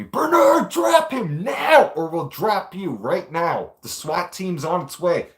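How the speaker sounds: high, loud, slow bellowed order; yelling officer. Retold or imitated. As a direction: scream the order from across the tiers. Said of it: "they keep yelling at me".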